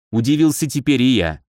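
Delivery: ordinary delivery, nothing marked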